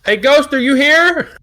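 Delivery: high, loud, drawn-out holler to a ghost; calling out. Acted. Challenged to talk to the ghost, calling out. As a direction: call slowly into the empty room, pitched high.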